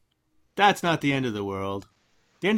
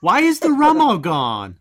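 mock outrage; 7 semitones higher, 8 dB louder, slower than usual. Quoting famous pirate movie line.

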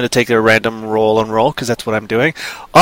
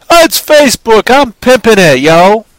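high, loud hyped-up hustler; hype bro voice; 9 semitones higher, 8 dB louder than usual. Retold or imitated. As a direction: pump it up like a street hustler. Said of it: "kind of thing".

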